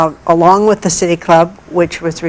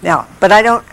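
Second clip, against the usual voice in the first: ordinary delivery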